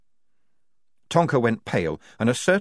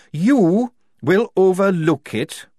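slow, louder, each word weighted; slow menacing threat; 9 semitones higher, 6 dB louder, slower than usual. A narrator's reading. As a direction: lean on every word like a threat. Tonka repeating, louder and slower.

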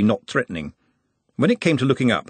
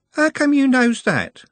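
put-on higher, slow, naive young woman; slow, high girl voice; 4 dB louder, slower than usual. A narrator's reading. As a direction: draw it out innocently. Juliet's pointed question.

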